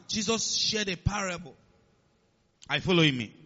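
usual speaking voice

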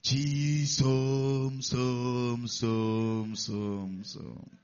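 drawn-out mock prayer fading to nothing; mock Nollywood prophet. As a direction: parody a movie prophet hearing God. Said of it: "he says".

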